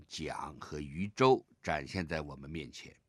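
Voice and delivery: ordinary delivery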